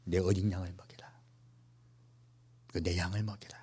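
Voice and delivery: soft whisper, voice dropped away; whispered Jesus. Retold or imitated. Jesus quietly telling Peter to feed his sheep, whispered. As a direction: breathe the command gently.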